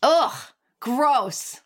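loud disgusted ugh, gross; annoyed ugh. Real, not acted. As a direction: groan it out in disgust. Reacting to Pink Lady apples.

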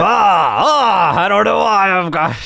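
blustering fake British accent, stretched "ah"s; hammed-up British. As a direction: play a silly sort of British person. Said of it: "I'm this kind of British person".

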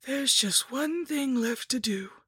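high, slow villainess voice; scheming lich, ominous. A narrator's reading. Lich announcing the last step of her ritual, slow and high. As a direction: draw it out with menace, pitched high.

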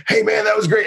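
high, bright fake-friendly greeting; fake chummy. Host buttering up rapper after interview, fake chummy. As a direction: overdo the chumminess. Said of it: "And I was just like".

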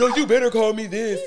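demanding. Retold or imitated. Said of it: "I'm not one to feel".

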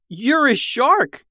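high, loud, stretched-out shout; slow, high-pitched exclamation at full voice; amazed yell. Acted. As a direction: yell it back in astonishment. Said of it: "yelled Jackson".

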